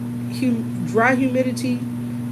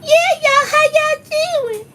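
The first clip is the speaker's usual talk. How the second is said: very high, loud valley-style greeting; imitating Lucretia's voice; 5 dB louder than usual. New teacher greets the class in a high valley voice. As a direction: sing out the hello like a bubbly newcomer. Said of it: "So she like".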